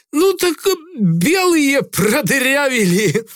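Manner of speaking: high, slow mocking sailor; jeering. A narrator's reading. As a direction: sneer out the excuse. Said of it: "глумливо хмыкнул Грицай". (глумливо)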